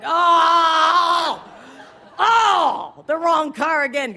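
high, loud mock groan of dismay; dismayed outcry. Rider realizing the pitch is coming, loud mock dismay. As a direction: cry out that you picked the wrong car. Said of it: "I'm like".

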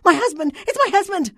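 distraught wife screaming high and loud; high-pitched, loud hysteria; hysterical screaming. A narrator's reading. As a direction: cry out frantically to the hospital clerk. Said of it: "in hysterical, screaming tones".

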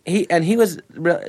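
ordinary talk

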